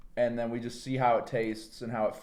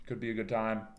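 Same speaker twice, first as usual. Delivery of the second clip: ordinary talk, nothing marked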